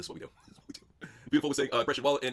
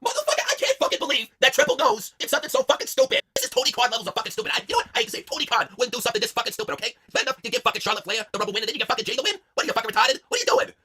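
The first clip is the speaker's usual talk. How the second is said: mock angry rant; 6 semitones higher, 3 dB louder than usual.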